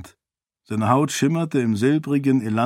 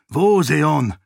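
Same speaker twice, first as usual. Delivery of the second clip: brusque, higher-pitched demand; 4 semitones higher, 4 dB louder than usual. A narrator's reading. Raoden demanding the location from the Seon, brusque. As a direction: fire the question off brusquely. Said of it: "fragte Raoden brüsk".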